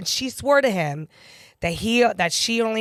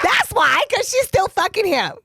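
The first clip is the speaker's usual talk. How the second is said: higher and louder, fired up; high excited insistence; 9 semitones higher, 3 dB louder than usual. Real, not acted. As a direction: punch it out, scandalised. Laughing retort to absurd claim.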